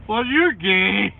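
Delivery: mocking troll. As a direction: drag out the taunt slowly. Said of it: "troll quote".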